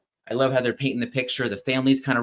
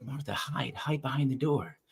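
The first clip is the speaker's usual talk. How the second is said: softer, conspiratorial hush; family hiding Martha, hushed; 8 dB quieter than usual. Retold or imitated. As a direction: keep it down so Dad won't hear.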